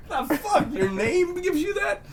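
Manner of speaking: very high, slow, laughing disbelief; mock astonishment. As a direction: squeal in disbelief. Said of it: "I'm like".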